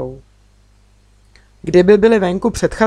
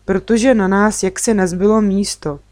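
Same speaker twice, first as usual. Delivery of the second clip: plain reading voice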